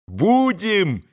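high, drawn-out fervent cry; ringing, stretched-out pledge; boys crying out. A narrator's reading. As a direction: call it out slowly as eager boys. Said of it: "прокричали опять мальчики".

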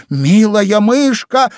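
high, loud, drawn-out call; exclaimed call. A narrator's reading. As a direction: call out pleadingly after someone leaving. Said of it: "воскликнула Алиса". (протяжно, громко зовёт высоким голосом)

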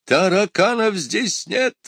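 high, drawn-out yell from another room. A narrator's reading. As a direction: yell indignantly at a high pitch. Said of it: "завопил из кабинета доктор". (высокий протяжный вопль)

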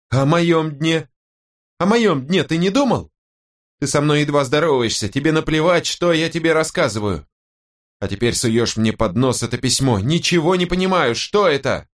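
high-pitched indignant wife; aggrieved wife. Acted. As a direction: reproach your husband, pitch riding high. Narrator plays aggrieved wife.

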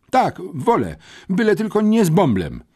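high, pleading insistence; desperate insistence. A narrator's reading. Same despairing pupil insists. (rozpaczliwe, wysokie zapewnienie)

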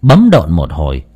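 ordinary delivery, nothing marked

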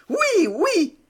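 voix d'enfant très aiguë et plus forte, toute fière; un petit garçon qui bombe le torse; enfant fier. A narrator's reading. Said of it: "dit l'enfant enorgueilli". (enfant fier, voix aiguë et forte)

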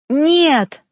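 loud desperate cry of no; shout. A narrator's reading. Lover screaming as she chooses to leave, loud cry. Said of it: "закричал Ноан".

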